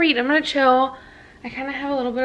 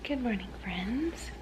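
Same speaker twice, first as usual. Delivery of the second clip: unvoiced morning whisper; hushed early greeting; 13 dB quieter than usual. Real, not acted. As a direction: whisper so as not to wake anyone.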